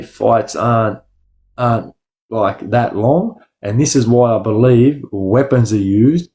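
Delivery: ordinary delivery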